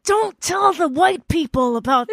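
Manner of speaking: drawn-out pleading impression of a local; mock offended locals. Podcaster imitating the Busan locals scolding her, put-on voice. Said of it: "They're like".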